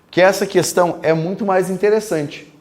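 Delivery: ordinary delivery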